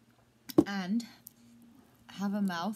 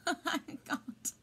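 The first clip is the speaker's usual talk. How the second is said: laughing helplessly; 8 semitones higher, 4 dB quieter than usual. Real, not acted. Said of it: "That's what's happening right now".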